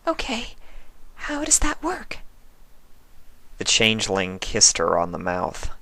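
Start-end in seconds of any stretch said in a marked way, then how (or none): 0.1-2.2 much higher, soft and timid; a shy pony, barely daring to speak; Fluttershy voice. A narrator's reading. Fluttershy asks the changeling.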